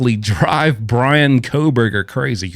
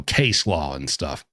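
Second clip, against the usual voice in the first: usual speaking voice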